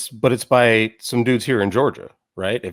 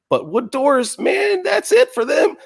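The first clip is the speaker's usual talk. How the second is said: much higher-pitched mocking rant; mock exasperation; 10 semitones higher than usual. Podcaster ridiculing aliens stopped by wooden doors, high-pitched mock exasperation. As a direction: squeal it in mock outrage.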